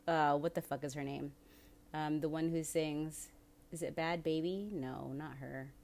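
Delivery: ordinary delivery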